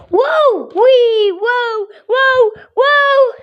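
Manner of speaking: high, thrilled whoas and squeals; excited whoas. Pretend. Child voicing bouncing birds, high thrilled whoas. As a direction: ride the bounce with each whoa.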